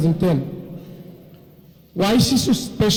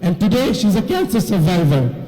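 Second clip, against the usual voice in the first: normal talking voice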